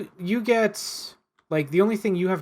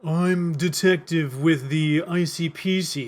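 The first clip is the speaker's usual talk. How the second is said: put-on slow, heavy Russian accent; mock Russian detective; much slower than usual. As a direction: play it as a deadpan Russian detective. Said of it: "supposed to be a Russian voice".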